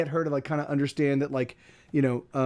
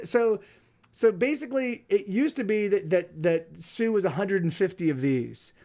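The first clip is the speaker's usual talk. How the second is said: ordinary talk, nothing marked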